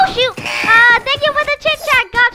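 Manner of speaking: high and loud, in a rush; panicked hurry to leave; alarmed. Acted. Alarm going off, rushing out.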